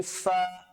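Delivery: ফা drawn out with air escaping; slow, airy ফা; air released. Shown as a demonstration. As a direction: let the breath flow out on ফা. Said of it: "উচ্চারণ করার সময় বাতাস বের হবে".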